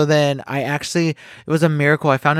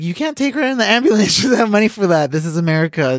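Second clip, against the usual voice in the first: higher, louder mock outrage with a laugh; retold protest; 8 semitones higher, 3 dB louder, faster than usual. Telling the story of refusing the ambulance for her friend, loud mock protest. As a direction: reenact yelling at the paramedics, half laughing. Arguing with EMTs over ambulance.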